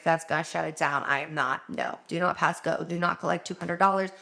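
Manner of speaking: ordinary delivery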